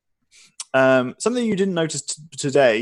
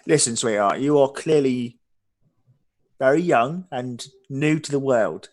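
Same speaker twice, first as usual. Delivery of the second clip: condescending; 2 semitones higher than usual. Acted. Innkeeper condescending.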